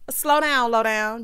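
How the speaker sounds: loud, firm "slow down"; loud warning. Real, not acted. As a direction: call out to slow her down. Host scolding fast romance.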